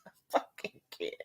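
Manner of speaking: whispered through laughter; breathless laughing. Real, not acted. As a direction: gasp it out laughing. Laughing too hard to talk.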